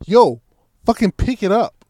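loud, high exasperated yell; mad, impatient order. Retold or imitated. Storyteller to his idle helper at the couch, mad shout. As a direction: act out losing patience, pitch jumping up. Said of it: "I'm getting visibly mad now".

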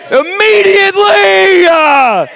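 full-force preaching. Acted.

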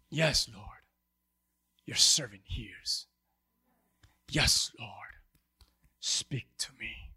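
low, slow surrender; hushed prayer. Acted. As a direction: drop into a reverent near-whisper. Preacher voices a surrendered prayer.

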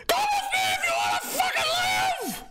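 very high shouted character voice; hammed-up screaming catchphrase; Billy Bob the Bigot Brick. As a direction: yell it in a strained, far-up comic voice. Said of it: "that's what he sounds like".

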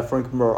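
"Mir" said as "Murr"; "Mir" with an "ur" vowel, as if it were correct; mispronounced name. Retold or imitated. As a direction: say "Mir" to rhyme with "fur". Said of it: "I call him Mir".

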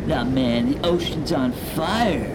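loud laid-back surfer bro; surfer dude. Acted. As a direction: drawl it like a beach bro. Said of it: "the surfer voices".

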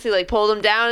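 ordinary talk

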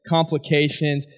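ordinary talk

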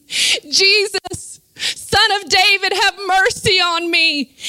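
high, ringing cry to Jesus; preacher acting out a plea for mercy; calling out. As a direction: cry out in fervent appeal. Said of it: "we will call out to him".

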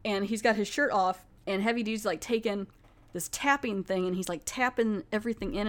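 usual speaking voice

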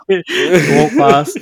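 normal talking voice

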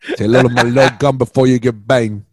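loud mock Cuban gangster accent; a hammed-up Scarface gangster growl; Tony Montana impression. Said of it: "are you doing a Tony Montana?".

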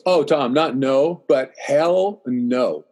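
weighty, spaced-out "hell no", falling at the end; emphatic refusal. Retold or imitated. Friend turning Tom down on running, slow and emphatic. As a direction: draw out "hell no" very slowly.

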